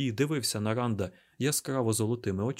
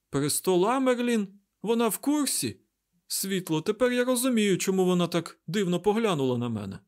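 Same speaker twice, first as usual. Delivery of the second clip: high-pitched, agitated realization; astonished; 9 semitones higher, 5 dB louder than usual. A narrator's reading. Mat learns the Amyrlin knows about Rand, astonished. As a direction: blurt it high and incredulous.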